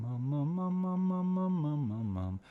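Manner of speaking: mum slides up high and back down; siren on mum. Shown as a demonstration. Coach demonstrating light compression on mum. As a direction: glide the mums up into head voice and back. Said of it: "On a very light compression level".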